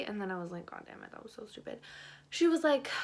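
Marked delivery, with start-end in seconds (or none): 0.7-1.8 fast low whispered curse under the breath; muttered self-reproach. Retold or imitated. Girl cursing herself under her breath after a bad lie. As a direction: mutter it at yourself quickly.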